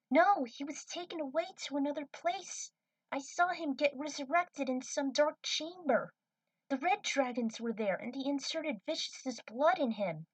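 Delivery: lightly voiced young woman pressing her case; young cadet urging the crew to go to Tharsis; Trudy's voice, insistent. Trudy insists Spike lives.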